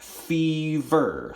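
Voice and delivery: "fever" drawn out as fee, ver; split into syllables. Shown as a demonstration. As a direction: sound out "fever" slowly in two beats. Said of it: "So we would clap it".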